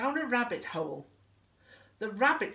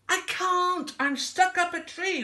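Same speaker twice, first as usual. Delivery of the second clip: high, loud call from above; plaintive high holler; calling for help; 12 semitones higher, 6 dB louder than usual. Acted. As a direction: shout it down from up high. Wilbur calls down from the tree.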